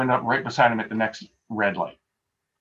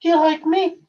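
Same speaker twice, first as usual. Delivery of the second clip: high-pitched little Scottish lady; tiny, high old-country voice; sweet little Scottish lady; 4 dB louder than usual. Retold or imitated. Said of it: "Elizabeth would say, ... sweet little Scottish voice".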